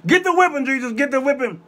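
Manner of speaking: high, fervent preacher's cheer; urging on. Acted. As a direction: egg him on at a high pitch. Urges Jesus on mid-sermon.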